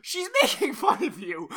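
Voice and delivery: cracking up at the caller's email; laughing. Real, not acted. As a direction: say it again through laughter. Repeats it while laughing.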